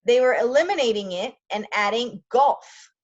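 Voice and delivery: expressive read-aloud of a memoir; storyteller voice for a class; story reading. A narrator's reading. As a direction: read the story with feeling, for learners. Reads memoir aloud in English.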